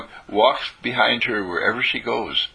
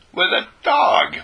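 much higher and louder, slow and marvelling; amazed exclamation; 12 semitones higher, 4 dB louder, slower than usual. A narrator's reading. Telling the family she loved a dog, amazed exclamation. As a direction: savour the wonder of it, drawn out.

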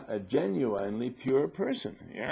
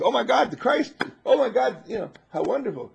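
mock gushing awe; 11 semitones higher, 5 dB louder than usual. Gushing over seeing Jesus Christ.

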